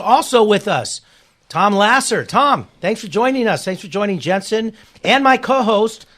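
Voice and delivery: loud, upbeat guest introduction; radio host intro. Acted. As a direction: announce the guests with energy.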